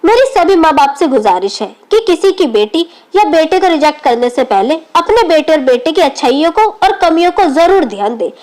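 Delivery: plain reading voice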